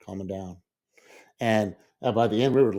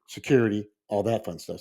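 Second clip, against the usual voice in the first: ordinary delivery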